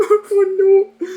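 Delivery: hammed-up high-pitched wail; mock crying. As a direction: wail it out in an exaggerated tearful squeal. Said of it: "starting to cry".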